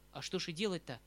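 ordinary delivery, nothing marked

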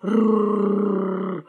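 long, low rolled growl; dog growl. A narrator's reading. Huge shaggy dog confronts the puppy, low rolling growl. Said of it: "зарычал пёс".

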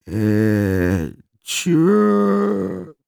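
put-on long drawn-out, high drunken grunt; dazed drunk. A narrator's reading. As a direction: stretch it out, slow to understand. Drunk man answers police, dazed.

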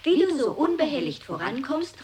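ordinary talk

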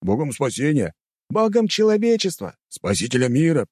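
high eager shouts from different people; high-pitched calling out; crowd calls. A narrator's reading. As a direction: toss out suggestions from the crowd. Crowd members shouting suggestions.

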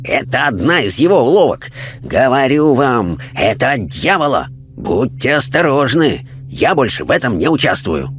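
hint of a preachy zealot; lightly voiced fanatic. A narrator's reading. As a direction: warn them off as a fanatic. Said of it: "шумный и вспыльчивый персонаж".

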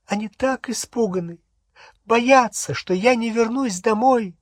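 high, tearful pleading; acted sobbing in a high pitch; sobbing mother. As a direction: sob out the worry for her children. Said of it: "Миссис Кроткот зарыдала".

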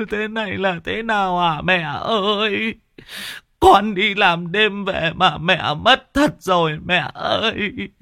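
high, slow sobbing lament; keen over a dead mother; grieving son wailing over his mother's body; wailing in grief. Acted. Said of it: "Chú Rêu về đến nhà thì mếu máo".

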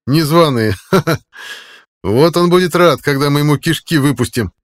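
loud, high, laughing menace; gloating taunt. A narrator's reading. Max taunting the cooks about their new chief, loud gloating. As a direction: crow it loudly with a nasty laugh.

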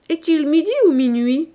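loud, high call across the dark room; shouting. A narrator's reading. Bossuet shouts to his friends in the dark tavern, loud and high. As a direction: call out loud, half joking. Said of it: "cria Bossuet".

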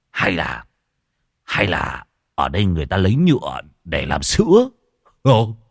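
trầm, chậm rãi ngơ ngác; giọng gã khờ ngẫm nghĩ; ngây ngô. Acted. Muôn Phọt ngây người. (giọng trầm ngây ngô)

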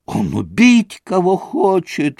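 slow, high horrified woman's cry; frightened maid crying out after him; horrified gasp. A narrator's reading. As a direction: throw up your hands in horror. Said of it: "всплеснула руками Феня".

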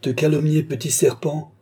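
high, loud scornful retort; acted furious disbelief; indignant outcry. A narrator's reading. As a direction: throw the word back in anger. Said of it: "s'écria Rousseau".

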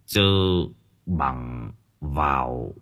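low, softened voice of an elder; gentle old man. A narrator's reading. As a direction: counsel the family gently and gravely. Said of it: "Ông cụ Thân nhẹ nhàng bảo".

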